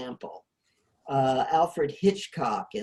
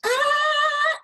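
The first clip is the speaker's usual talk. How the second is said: high-pitched mock scream, held; imitated scream; 4 dB louder than usual. Lecturer voicing the woman who finds a body, imitated scream. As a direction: let out a sustained high imitation shriek. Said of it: "she screams".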